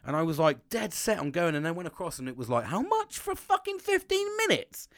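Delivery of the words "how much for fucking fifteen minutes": much higher, slow, mock-outraged squeal at the price; mock outrage. Recounting the jet ski price to the seller, mock-outraged squeal. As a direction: squeal out the price shock, drawn out.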